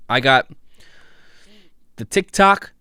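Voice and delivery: high, loud showman build-up to the week; hyped announcement. Acted. Podcast host hyping listeners for his TikTok of the week, loud and high.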